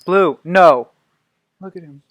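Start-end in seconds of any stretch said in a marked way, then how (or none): 0.0-0.9 sharp shouted no; loud shout. Real, not acted. Owner yelling at misbehaving dog, loud shout. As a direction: yell the dog off.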